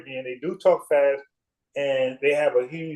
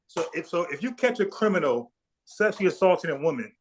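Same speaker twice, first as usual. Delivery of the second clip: normal talking voice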